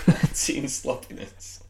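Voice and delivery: laughing. Real, not acted. Laughing at rival's score.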